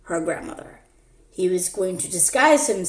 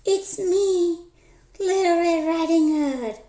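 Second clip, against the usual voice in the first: put-on much higher, sweet little girl; 9 semitones higher than usual. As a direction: pitch it up like a small girl.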